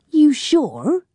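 sharp, high-pitched doubt; doubtful. A narrator's reading. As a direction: challenge him disbelievingly. Fowler doubting Allbones.

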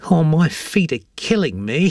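high woman character voice; weary shopper Angela. A narrator's reading. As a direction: complain of sore feet, in character. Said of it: "said Angela".

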